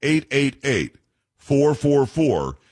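phone number read out slowly; slow, careful number. Real, not acted. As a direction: say each group of digits slowly, with gaps between. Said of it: "I'll say it slowly".

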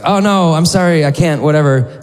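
mock-apologetic refusal; awkward brush-off. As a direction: brush off a beggar with a quick apology. Brushing off a beggar, retold.

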